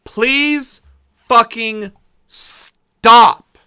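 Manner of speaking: loud, slow pleading; exasperated plea. Real, not acted. Vlogger begging Russia to stop, loud and slow. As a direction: beg them to stop, drawn out.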